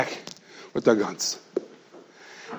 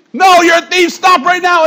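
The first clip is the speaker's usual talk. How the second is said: shouting; 12 semitones higher, 4 dB louder than usual. Retold or imitated. Real cop shouts back.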